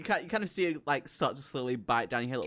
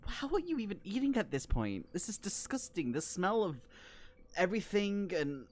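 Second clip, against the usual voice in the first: higher-pitched, appalled protest; aghast, voice pitched up in disgust; 7 semitones higher than usual. Acted. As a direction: play someone revolted by a filthy stench. Game master voices Vicky disgusted by sewer.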